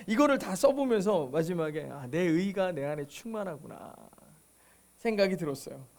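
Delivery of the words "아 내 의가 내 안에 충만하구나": low, soft inner thought; quiet realization. Retold or imitated. Preacher recalling a realization about himself, low and subdued. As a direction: let it sink in, lower and softer. 깨달음의 속말을 재연.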